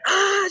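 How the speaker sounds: very high mock cry of pain; a pained "ah" yelled in imitation, without real volume; high-pitched retold yelp; imitated yell.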